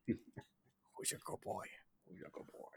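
whispered, slow, breathy dog praise; James Spader whisper. Retold or imitated. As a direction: hush it like a creepy movie star to his dog. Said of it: "James Spader has a dog".

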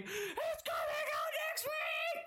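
very high, slow squeal; squealing excitement. Retold or imitated. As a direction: squeal it with joy. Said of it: "And I'm like".

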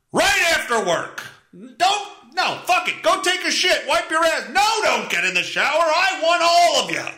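high-pitched shouted demands; hammed-up, frantic insistence; overboard rant. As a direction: order them about in a frenzy of devotion. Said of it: "That was a little overboard".

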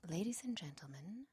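hushed cabin announcement; breathy airline stewardess whisper; flight attendant whisper. Pretend. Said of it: "don't you feel like saying".

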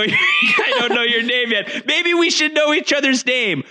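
high, lively in-character banter. Acted. Player in character greeting the swimmer, high and playful. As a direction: play it up as the bandaged survivor.

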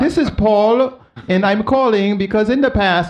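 put-on high-pitched Indian-accented caller; mock Indian phone-call accent; imitated Indian accent. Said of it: "place in India, and the guy's like".

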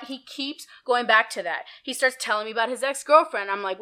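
ordinary talk, nothing marked

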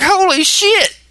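high, loud stunned curse; shocked exclamation. Retold or imitated. Producer reacting to a host who's never heard of Ebola, stunned. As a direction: blurt it out in pure disbelief. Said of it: "I'm like".

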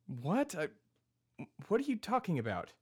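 high-pitched, bewildered question; baffled. Acted. Told the name is Thebe Stard, baffled. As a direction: pitch jumps up in confusion.

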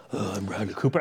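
very low, hushed movie-star growl; Bradley Cooper. Acted. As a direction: overact it, way down low and quiet. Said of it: "did you overact like Bradley Cooper".

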